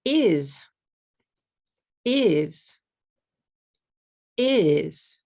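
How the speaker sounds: "Is" said clearly as one syllable, repeated; slow clear pronunciation model; careful demo. Shown as a demonstration. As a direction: model "Is" in one clean beat for learners. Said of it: "How many sounds am I making?".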